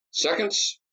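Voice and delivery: high, ringing order; command. A narrator's reading. The senator ordering the seconds back, commanding. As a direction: call it across the sandbar. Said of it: "he commanded".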